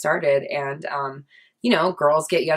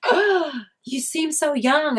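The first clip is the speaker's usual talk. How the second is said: high, slow, gushing mock surprise; people gushing; 11 semitones higher, slower than usual. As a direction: mimic people cooing over how young she looks.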